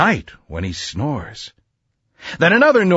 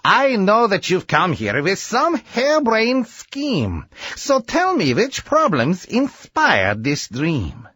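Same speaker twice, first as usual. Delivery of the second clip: lightly voiced dry scientist, a bit higher; a hint of a wry old doctor sizing up a scheme; Doc Sam's voice; 5 semitones higher than usual. Scientist answers his eager young friend, lightly voiced and dry. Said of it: "He said".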